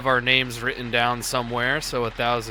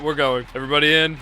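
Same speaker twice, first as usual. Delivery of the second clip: ordinary talk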